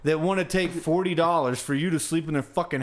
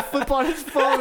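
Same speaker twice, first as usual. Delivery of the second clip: ordinary delivery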